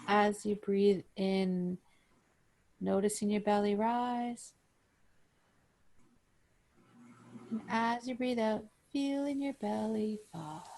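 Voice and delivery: calm, even breathing-guide voice; guided meditation. Acted. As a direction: pace each cue with the breath, unhurried.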